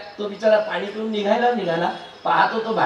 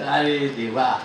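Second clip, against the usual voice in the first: ordinary delivery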